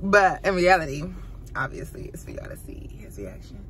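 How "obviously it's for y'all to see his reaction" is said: whispered aside to the camera; whispering. Real, not acted. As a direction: whisper the secret plan. Secret aside about the prank.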